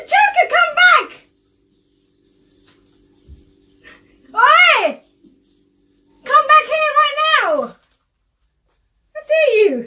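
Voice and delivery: loud high calls of come back; shouting. Real, not acted. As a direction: yell after him to come back. Calling him back from sink.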